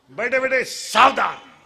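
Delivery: barked parade-ground order, loud on सावधान; drill command. Acted. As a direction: call it like a drill teacher to kids. (कवायतीचा जोरदार आदेश)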